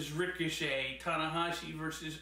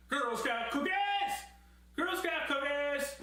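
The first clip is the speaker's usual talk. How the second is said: excited inner shout; 10 semitones higher than usual. Retold or imitated. Reviewer voicing his silly thought mid-match, excited cry. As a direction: blurt it out like a kid spotting a treat. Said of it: "went into my mind as well".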